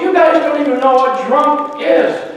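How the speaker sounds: can't believe what he's hearing; exasperated dad. Retold or imitated. Pastor dad scolding his boys in the tub, incredulous. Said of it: "And Dave goes".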